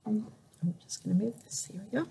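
soft unvoiced mutter to herself; whispered aside. Real, not acted. Reader moving something aside, whispering to herself. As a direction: whisper it under the reading.

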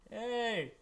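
high, loud chummy greeting; cozy up to the raiders eagerly; friendly villain buddy voice. Acted. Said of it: "He joins up with the enemies like".

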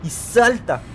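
high-pitched cry on the leap; urgent jump call. Real, not acted. Player timing the leap upward, urgent and excited.

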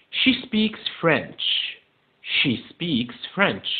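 very slow, separated English words; slow model reading. Shown as a demonstration. Teacher repeating a statement for pupils, slow model reading. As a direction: spell it out word by word.

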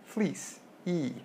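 "Fleece" then "E", the long ee vowel held clear; vowel demo. Shown as a demonstration. Teacher to class, modelling "Fleece" and its ee vowel. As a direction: isolate the ee of "Fleece". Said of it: "the fleece vowel makes the E sound".

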